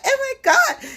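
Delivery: a hint of a frightened girl; scared gasp. Girl in the movie realizing danger, high scared gasp. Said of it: "the girl was scared".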